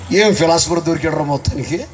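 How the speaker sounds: usual speaking voice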